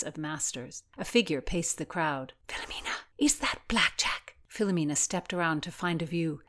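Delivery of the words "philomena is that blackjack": urgent hushed whisper; an alarmed whisper to a friend. Acted. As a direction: whisper so he won't hear. Alarmed aside to companion.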